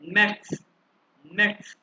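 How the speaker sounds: मैक्स ending in "ts", as "mets"; मैक्स, one syllable closing on ts; German pronunciation. Shown as a demonstration. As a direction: model मैक्स with a crisp final ts. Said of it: "German वाले इसको pronounce करते हैं".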